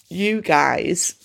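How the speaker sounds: ordinary talk, nothing marked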